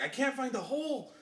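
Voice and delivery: whiny mock voice. Retelling a friend's in-game complaint, whiny and high. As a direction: pitch it up like a helpless kid.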